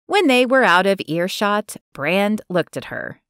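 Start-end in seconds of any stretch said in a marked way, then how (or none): none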